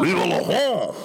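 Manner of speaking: exaggerated high mock French cry; fake French accent. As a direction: shout it like a hammy Frenchman.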